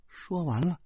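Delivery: usual speaking voice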